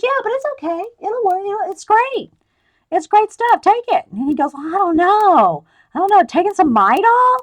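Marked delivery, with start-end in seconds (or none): 0.0-4.0 lightly voiced cheerful teacher, pitched up; breezy, pushing the pills on him; mock teacher voice. Teacher offers Midol to colleague. 4.6-7.4 unsure, dragging the words out; hesitant doubt. Retold or imitated. Doubtful man about women's pills.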